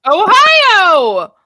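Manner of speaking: big, high shout of Ohio. Acted. Shouting Ohio to the crowd. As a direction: yell Ohio like a rally cry.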